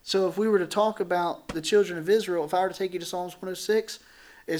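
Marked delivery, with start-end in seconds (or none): none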